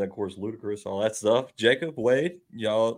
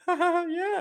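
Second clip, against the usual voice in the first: fake polite laugh. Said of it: "she was, like".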